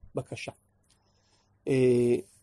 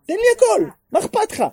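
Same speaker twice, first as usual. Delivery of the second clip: high, loud urging; emphatic exhortation; 8 dB louder than usual. Retold or imitated. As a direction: push them to ask for everything. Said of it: "אני אומר". (דרבון נלהב בקול גבוה וחזק)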